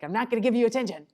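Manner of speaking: a parent firmly refusing to give in; stubborn parent. Retold or imitated. Parent stonewalling a screaming child, huffy and quick. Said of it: "because you're like".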